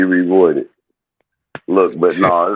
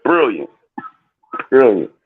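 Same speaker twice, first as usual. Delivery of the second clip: higher pitched posh "Brilliant" twice; mock British accent; 7 semitones higher than usual. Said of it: "I'm gonna say something like".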